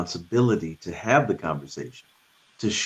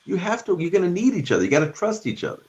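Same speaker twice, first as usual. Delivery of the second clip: high, urgent parental plea; pleading with the kids; 12 semitones higher than usual. Retold or imitated. Parent urging bickering kids to respect each other, high pleading voice. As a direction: lift the voice way up and beg them to stick together. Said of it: "And I'm trying to say".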